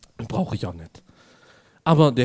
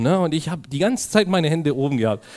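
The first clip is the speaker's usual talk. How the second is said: ordinary talk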